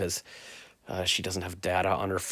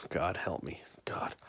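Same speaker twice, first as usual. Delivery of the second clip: softer unvoiced whisper; whispered plea; 3 semitones lower, 7 dB quieter, slower than usual. Real, not acted. Worn out making the bed, whispered plea. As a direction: breathe it out like a tired prayer. Weary aside to himself.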